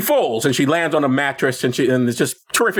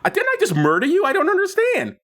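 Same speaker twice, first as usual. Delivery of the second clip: higher, bewildered, laughing through it; a laughing, puzzled "didn't I just murder you?"; mock confusion, voice climbing high; baffled disbelief. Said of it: "Porter's like".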